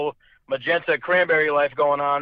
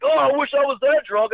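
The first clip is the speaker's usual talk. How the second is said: put-on passing kids; 10 semitones higher than usual. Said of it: "mad kids walking by like".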